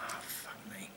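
barely voiced whisper; whispered despair. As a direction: breathe it out in a whisper. Despairing thought.

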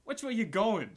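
high, loud little halfling voice; high-pitched, annoyed character shout; indignant halfling. Acted. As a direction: pipe up loudly at whoever bumped into you. Halfling tripped into, game master voices him.

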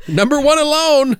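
high, mocking proud shout; sarcastic cheer. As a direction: cheer it in mock pride. Mocking the covid ranking.

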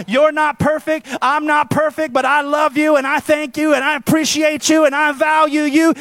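loud, high fervent preacher; preaching at full force. Acted. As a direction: drive it home to the congregation.

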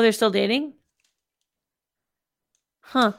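ordinary talk, nothing marked

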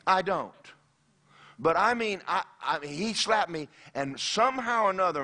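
ordinary talk, nothing marked

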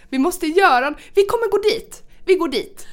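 excited friends. Retold or imitated. Friends panicking she's leaving.

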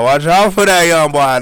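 high loud slow southern drawl imitation; southern accent. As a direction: imitate a Southern rapper's hype. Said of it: "He's like".

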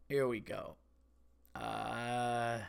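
ordinary talk

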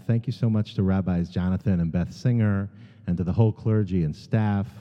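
ordinary talk

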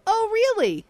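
high, loud fake surprise; mock surprise. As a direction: play up a fake "oh really". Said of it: "I'm going to just go".

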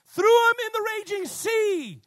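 high, loud climactic proclamation; dramatic scripture reading. A narrator's reading. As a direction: cry out the verse over a storm.

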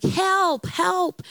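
high-pitched imitating call for help; mild mock scream of a frightened woman; mock cry for help. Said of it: "it's like".

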